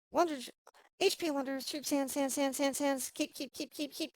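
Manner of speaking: clumsy auctioneer patter, rattling repeats. Pretend. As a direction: call it like a bumbling auctioneer. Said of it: "try doing this in an auctioneer's voice".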